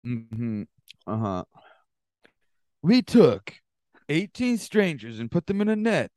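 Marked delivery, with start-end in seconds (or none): 2.8-6.1 higher, grand announcer voice; mock host pitch. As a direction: sell it like the show's host. Parodying the Survivor host pitch.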